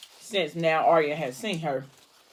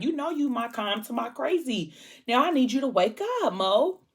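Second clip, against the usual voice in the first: retold pleading; 7 semitones higher than usual. Friend pleading with unconscious friend to wake.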